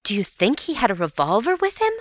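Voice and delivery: usual speaking voice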